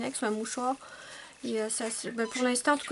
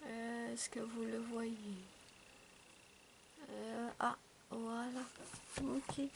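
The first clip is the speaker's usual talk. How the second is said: much softer murmured commentary; speaking softly; 10 dB quieter than usual. Real, not acted. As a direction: keep your voice down so the cat sleeps. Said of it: "Je parle doucement".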